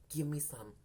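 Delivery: breathy whispered request, voice dropped to nothing; a hushed, shy ask for a little action. Retold or imitated. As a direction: whisper it like a sly suggestion. He quietly asks her for sex.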